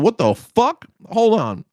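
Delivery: shocked. Retold or imitated. Shocked retold reaction.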